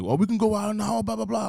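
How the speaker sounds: mock tough customer. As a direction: dare him to step outside. Customer challenging to fight.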